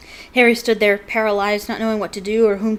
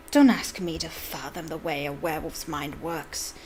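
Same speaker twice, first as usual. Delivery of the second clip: hissed; 3 semitones lower, 4 dB quieter than usual. Acted. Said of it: "hissed Snape".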